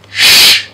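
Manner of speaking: "sh" as a long unvoiced hush; sh sound. Shown as a demonstration. Teacher modelling the sound, "sh" long and unvoiced. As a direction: hold "sh" as pure breath. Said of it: "the sound comes from your mouth".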